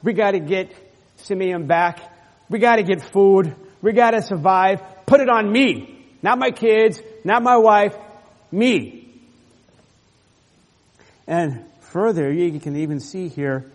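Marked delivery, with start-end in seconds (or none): 0.0-9.1 louder, higher urgent resolve; rally them, put it on yourself; Judah stepping up. Acted. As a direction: Judah urging brothers, forceful and raised. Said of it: "stepping in the gap and saying".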